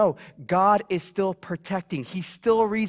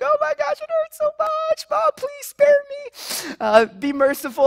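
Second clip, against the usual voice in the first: high, wailing mock sobs; fake crying; 4 dB louder than usual. Boy fake-crying to his mom for mercy, high hammed-up wail. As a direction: ham up a fake cry to dodge the spanking. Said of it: "doing the fake crying thing".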